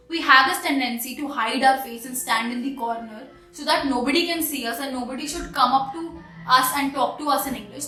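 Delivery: ordinary talk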